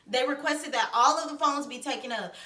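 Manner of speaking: usual speaking voice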